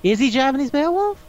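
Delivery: high, rising incredulous question; real surprise. Real, not acted. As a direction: ask it in astonished disbelief. Surprised by co-host's casting fact.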